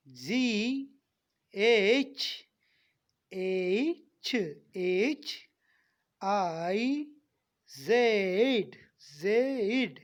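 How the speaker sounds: जी, एच, आई, जेड each loud with a long gliding vowel; stretched letter names. Shown as a demonstration. Teacher modelling letters for students, loud and drawn out. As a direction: drag out every vowel in जी, एच, आई, जेड. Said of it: "थोड़ा खींच के".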